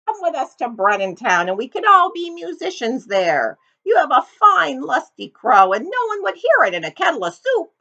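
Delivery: put-on a cheerful old donkey calling out; friendly donkey. Donkey voice in the story.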